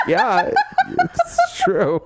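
breaking up with laughter mid-line, slow; laughing. Real, not acted. Cracking up at her own joke, laughing. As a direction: wheeze the words out through laughter.

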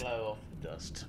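low, hushed spooky echo. Acted. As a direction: breathe it out hushed.

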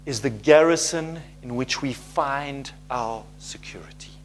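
breathy hush for emphasis; whispered. Real, not acted. Preacher whispers to the congregation about God's power. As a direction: let it fall to a whisper. Preacher hushes a key line.

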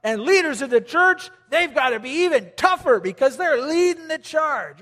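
loud, scoffing macho guy; mock the guys who balk at love; hammed-up tough guy scoffing at love.

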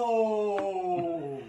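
drawn-out awestruck "ohhh"; long stunned "oh". Retold or imitated. Viewer reliving the silent ship split, long awed "oh". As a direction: hold one long, falling gasp of amazement. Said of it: "I was like".

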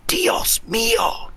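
slow, breathless whisper of awe; Spanish teacher whispering in shock; whispered Dios mío. Retold or imitated. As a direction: whisper it in stunned amazement. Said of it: "my Spanish teacher just went".

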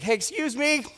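calling out. Retold or imitated. Calling to two passing ladies.

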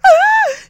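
loud high delighted gasp; excited gasp. Retold or imitated. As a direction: gasp in mock thrill. Saleswoman gasping with delight.

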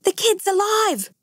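high, amazed exclamation without real volume; excited shout. A narrator's reading. Rescuer announces the boy is alive, excited shout. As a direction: call out the great news, pitched high. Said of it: "Rodder shouted".

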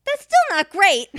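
loud, high-pitched objection; exclaimed protest. Real, not acted. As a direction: cry out in comic disbelief. Protests at the stabbing excuse.

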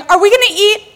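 put-on high, eager child asking again; impatient kid. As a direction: play the hungry kid at the door. Kids knocking on the door.

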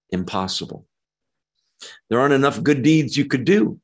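ordinary talk, nothing marked

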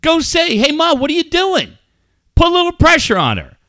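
high-pitched, fed-up prodding; worked-up dad retelling his scolding; retold exasperated order.

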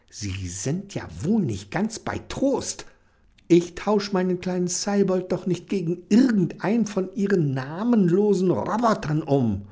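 a touch of indignant scolding; annoyed. Acted. Kenny scolds the salesman, mildly indignant. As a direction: tell him off, mildly cross. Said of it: "mit einem wütenden Blick".

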